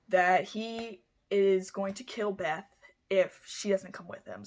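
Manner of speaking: normal talking voice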